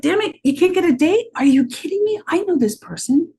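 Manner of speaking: lively friend-to-friend pitch; excited friend. Shown as a demonstration. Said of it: "You'd be like".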